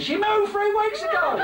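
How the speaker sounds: put-on high squawky old girl voice; old woman. Comic toothless old woman squawking at the visitor. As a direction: squeal it like a toothless granny.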